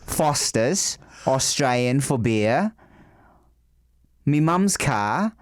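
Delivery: mock Australian ad-style accent; unhurried, put-on Australian accent. Shown as a demonstration. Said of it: "an equation for Australian accent".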